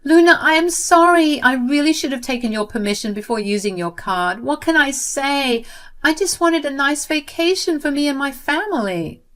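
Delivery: acted tearful sister-in-law; crying apology. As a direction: beg forgiveness through mock tears. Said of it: "She cried and said".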